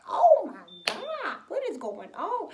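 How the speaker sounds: high, loud, drawn-out alarm; shocked grandma. Retold or imitated. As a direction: cry out in disbelief like a shocked grandma. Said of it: "My grandma was like".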